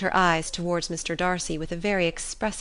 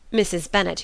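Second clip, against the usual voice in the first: usual speaking voice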